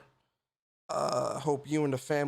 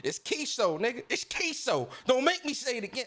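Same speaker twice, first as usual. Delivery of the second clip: higher, insistent imitation of the viewer; mock annoyed viewer; 7 semitones higher than usual. Imitating the viewer King insisting on his name, higher and mock annoyed. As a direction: play the viewer correcting him, a bit fed up. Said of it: "King probably be like".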